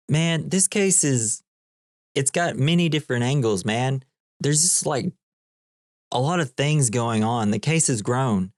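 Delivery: lightly voiced stoner reasoning; rambling Dude. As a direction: play a dazed guy puzzling it out aloud. Acts out the Dude explaining.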